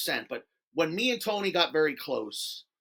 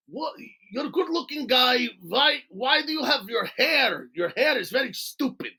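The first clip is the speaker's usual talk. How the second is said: higher-pitched, thick put-on Russian accent; 6 semitones higher than usual. Tarzan mocking his haircut for no reason, Russian accent. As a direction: needle him in a mock Russian accent. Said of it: "I like doing the Russian accent".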